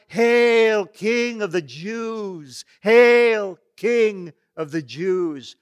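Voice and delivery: loud, high, sneering hail drawn out slowly; mocking call. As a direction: jeer it loudly like soldiers making sport. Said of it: "calling out".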